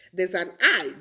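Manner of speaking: normal talking voice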